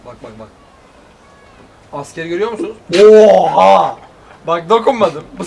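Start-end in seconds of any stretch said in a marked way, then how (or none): none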